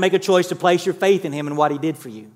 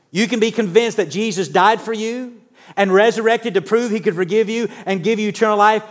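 ordinary talk, nothing marked

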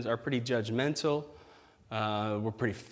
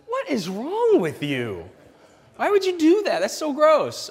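disgusted disbelief; 12 semitones higher, 5 dB louder than usual. Acted. Pastor reacting to a kid licking a bus floor, high disgusted squeal. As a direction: stretch it, then squeal the why.

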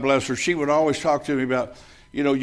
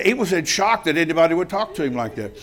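ordinary talk, nothing marked

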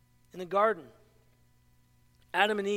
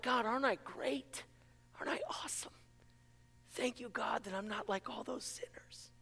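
mock self-satisfied prayer, whispered; smug prayer; 11 dB quieter than usual. Preacher mocking a proud believer praying, hushed and smug. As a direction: pray like a proud hypocrite, hushed.

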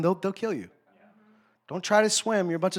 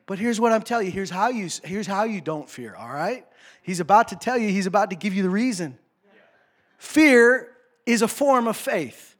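ordinary delivery

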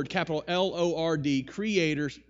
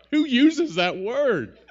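mock incredulous; 8 semitones higher, 8 dB louder, slower than usual. Real, not acted. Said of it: "I do".

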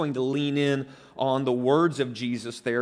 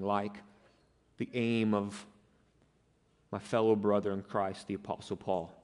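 earnest prayer. Real, not acted. Pastor leads congregation in prayer.

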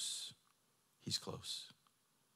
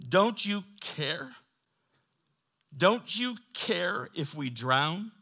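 slow, raised plea; acted-out, emphatic begging; pleading disciples; 7 semitones higher, 8 dB louder, much slower than usual. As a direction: draw out the question in appeal. Preacher voices disciples' plea to Jesus.

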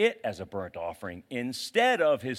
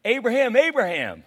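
loud, higher eager call; joyful calling out; 9 semitones higher, 10 dB louder than usual. Acted. God greeting faithful Abraham, loud joyful call. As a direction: call him like an old friend you're thrilled to see. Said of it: "He goes".

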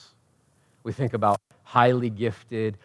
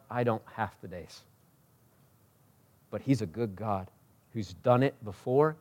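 ordinary talk

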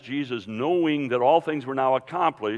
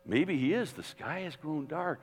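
higher-pitched, hushed wondering; hopeful; 5 semitones higher, 5 dB quieter than usual. Acted. Bystanders wondering if this is the Messiah, hopefully. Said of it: "it was a note of hope".

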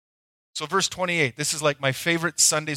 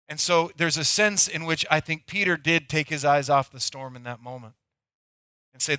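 normal talking voice

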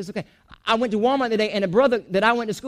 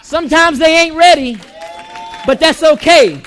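higher and louder, full preaching force; preaching shout; 5 semitones higher, 6 dB louder than usual. Real, not acted. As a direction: belt it out to the congregation. Preacher's rising climax.

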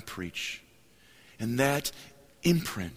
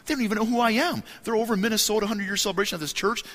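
excited inner voice; 10 semitones higher, faster than usual. Retold or imitated. Young preacher's excited self-talk.

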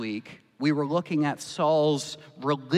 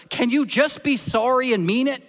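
high, loud fed-up plea; a parent at the end of their rope; exasperated parent; 9 semitones higher, 7 dB louder than usual. Acted. Parent begging a child for a real apology, loud exasperation.

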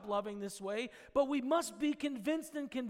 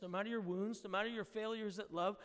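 usual speaking voice

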